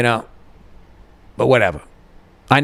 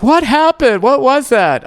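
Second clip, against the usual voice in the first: imitating front desk surprise; 11 semitones higher, 3 dB louder, slower than usual. Front desk staff reacting.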